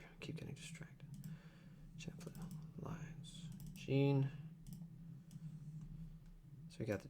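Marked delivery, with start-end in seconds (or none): 0.0-3.5 unvoiced mutter under his breath; whispered self-talk at the desk. Real, not acted. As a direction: whisper it to yourself while working. Muttering to himself while editing.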